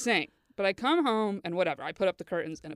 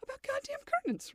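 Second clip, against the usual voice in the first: incredulous; 13 semitones higher than usual. Retold or imitated. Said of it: "But I was like".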